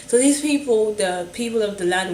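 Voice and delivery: ordinary delivery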